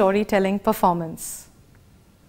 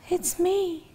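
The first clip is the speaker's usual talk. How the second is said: much softer and higher, tiny voice; 7 semitones higher, 7 dB quieter than usual. Shown as a demonstration. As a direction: say it small and soft. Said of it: "We can change the volume".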